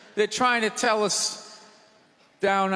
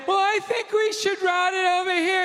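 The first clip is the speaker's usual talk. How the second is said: much higher, prissy know-it-all; mock meddling wife; 10 semitones higher than usual. Plumber's wife butting in, high and bossy. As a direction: chime in like you know best.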